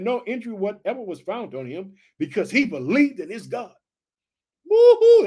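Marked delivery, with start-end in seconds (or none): 4.6-5.2 high, loud joyful whoop; excited whoop. Real, not acted. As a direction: shout for joy. Preacher shouts in excitement.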